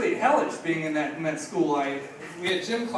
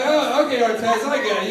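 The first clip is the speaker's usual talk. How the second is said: nervous loud reply; 4 semitones higher, 7 dB louder than usual. Retold or imitated. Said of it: "And I go".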